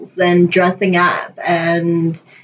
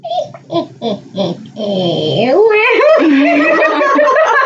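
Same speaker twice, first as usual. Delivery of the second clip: put-on high cackling old hag laugh; witch laugh; 11 semitones higher than usual. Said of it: "so you witch laughed".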